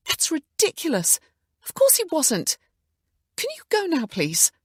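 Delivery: high, loud, indignant; indignant outburst. A narrator's reading. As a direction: push them out the door in outrage. Emma angrily ordering them out.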